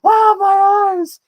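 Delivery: high, loud, stretched cry of pain; mock agony. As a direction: ham up a man blinded by spray. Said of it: "He's like".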